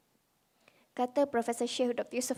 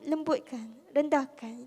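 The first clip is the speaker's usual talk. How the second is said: ordinary talk, nothing marked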